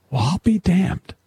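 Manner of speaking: retold astonishment, pitched high; amazed. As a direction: recall your own amazement, voice jumping up. Retells first thought at million.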